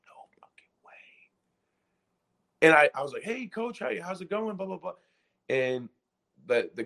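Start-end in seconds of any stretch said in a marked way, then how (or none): 0.1-0.9 stunned breathless whisper; hushed no-way whisper; whispered disbelief. Retold or imitated. Muted phone, stunned reaction. 3.2-4.9 high, soft, overly polite voice; higher-pitched star-struck hello to the coach; nervous polite greeting. Retold or imitated. Answering Calipari's call politely.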